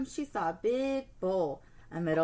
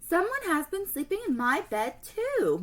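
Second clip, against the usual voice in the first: crying out; 8 semitones higher, 4 dB louder than usual. Acted. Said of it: "Mama Bear cried".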